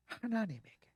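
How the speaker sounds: ordinary delivery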